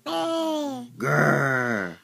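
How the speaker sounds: low playful growl; bear growl. Shown as a demonstration. Parent showing a toddler the bear sound, low growl. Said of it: "what's the bear say?".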